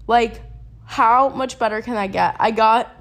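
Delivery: ordinary delivery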